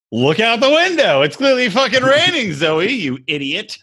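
high, exasperated comic retort; mock exasperation. Phone answering the actress in the commercial, mock exasperated. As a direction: tell off a clueless person, hammed up.

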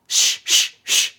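whispered shh; host hushing listeners before a call plays, whispered; shushing. Real, not acted. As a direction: hush the room before the call. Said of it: "I'm playing a call".

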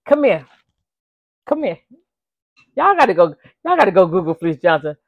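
a hint of a coaxing prison boss; lightly voiced menacing come here; quoting Fleece. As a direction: lure him in, half playful. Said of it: "he used to tell him".

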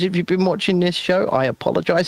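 ordinary talk

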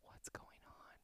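hushed, worried "What's going on?"; whisper. Retold or imitated. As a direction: breathe it out with no voice, gently concerned. Partner finds her crying early morning.

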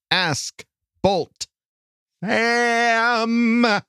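big rising announcer voice. Acted. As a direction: call out the segment name like a game show. Announcing the segment title.

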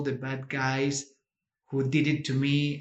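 ordinary talk

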